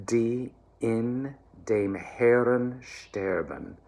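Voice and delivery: slow, carefully shaped German; German diction demo. Shown as a demonstration. Choir coach modelling the phrase, slow careful German. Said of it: "next phrase is".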